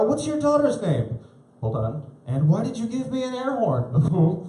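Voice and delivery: high, slow incredulous voice; baffled stranger. Retold or imitated. Stranger asking a parent about her daughter's odd name, high and incredulous.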